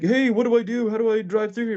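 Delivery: high, loud mock-helpless voice; clueless friend. Imitating southern friends facing snow, high helpless voice.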